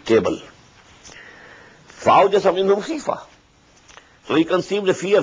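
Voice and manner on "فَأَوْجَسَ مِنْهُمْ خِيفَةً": rapid recitation, pitched higher; raised quick recitation. Acted. Teacher repeating the verse higher and faster. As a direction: recite the verse fast with a lifted voice. Quran recitation in tafsir lesson.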